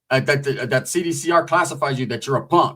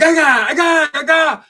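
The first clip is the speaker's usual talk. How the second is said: mock stabbing yell; 12 semitones higher, 6 dB louder, slower than usual. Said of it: "he was like".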